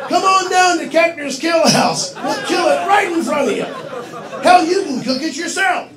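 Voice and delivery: loud, high huckster sales call; hammed-up commercial pitch. Owner pitching his kill-house restaurant, exuberant ad shout. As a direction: holler it like a local TV ad.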